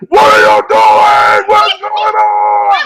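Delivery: loud, slow, crying cartoon-girl shout; mad Elmira. Cohost imitating Elmira getting mad, wailing shout. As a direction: wail it out like a furious Elmira. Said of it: "as soon as she got mad".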